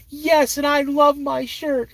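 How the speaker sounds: put-on high, slow, insistent child; little girl voice. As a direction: insist proudly like a small girl. Daughter insisting.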